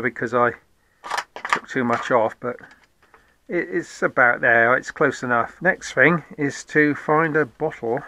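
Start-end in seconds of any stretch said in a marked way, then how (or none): none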